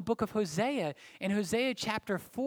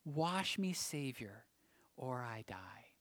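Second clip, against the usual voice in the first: hushed; 6 semitones lower, 8 dB quieter, slower than usual. Acted. Hymn lyric ending in hush.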